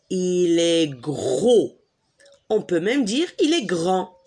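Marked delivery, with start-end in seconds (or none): 0.1-1.8 il est gros, drawn out slowly; slow emphatic gros. Shown as a demonstration. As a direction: stretch out "gros" to show size. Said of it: "Et comment il est le poisson?".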